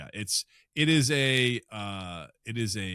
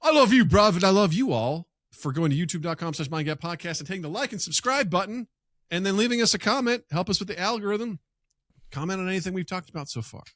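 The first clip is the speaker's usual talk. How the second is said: podcast host plug; 8 semitones higher, 6 dB louder than usual. Acted.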